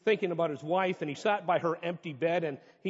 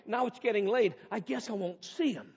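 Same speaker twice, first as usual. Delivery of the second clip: wife's diary voice; 6 semitones higher, 5 dB louder than usual. Acted. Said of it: "he read another entry".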